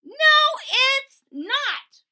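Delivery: high, loud, slow yell; shouting. Acted. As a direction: scream the denial. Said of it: "shouted Ruthie".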